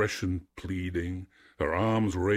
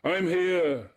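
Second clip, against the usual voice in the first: high, loud echoing cry; 12 semitones higher, 5 dB louder than usual. A narrator's reading. Echo catching Narcissus's words, crying out eagerly. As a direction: throw the call back, pitched high and loud. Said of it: "she cried".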